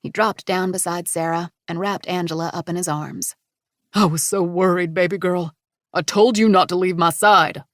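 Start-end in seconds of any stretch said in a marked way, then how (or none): 3.9-7.6 louder, relieved father scolding gently; a dad hugging his lost girl; worried father. Acted. Brock's voice.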